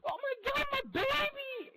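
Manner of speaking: very high, loud, hammed-up shriek; overreacting mother. Mother seeing her injured child, wildly overdone high shriek. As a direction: imitate a mother wailing in horror.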